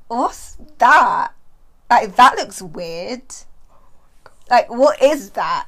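slow, loud, confused; puzzled, baffled. Retold or imitated. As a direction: act out bewildered disbelief. Retelling her puzzled younger self.

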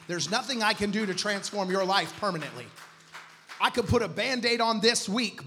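emphatic, projected sermon voice; forceful preaching. Acted. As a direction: drive the warning home to the congregation.

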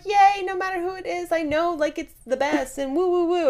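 high, bubbly mock cheer; fake cheerful. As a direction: gush like a fake-nice friend. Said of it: "your friend's like".

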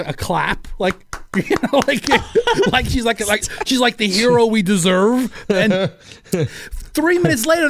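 ordinary delivery

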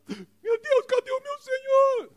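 very high, wailing hammed-up cry, slow; mock crying women. Preacher imitating weeping women at the tomb, very high hammed-up wail. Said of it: "Aí vem elas chorando".

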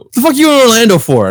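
high, loud mock yell; dad yelling at his son; angry dad. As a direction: imitate the dad shouting. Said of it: "first thing he said".

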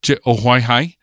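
"Je ohuai hai" said as a Piraha phrase for I look for this. Shown as a demonstration. Linguist demonstrating Piraha for I look for this, careful Piraha pronunciation. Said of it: "I say".

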